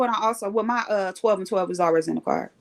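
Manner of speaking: ordinary delivery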